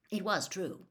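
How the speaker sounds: ordinary delivery, nothing marked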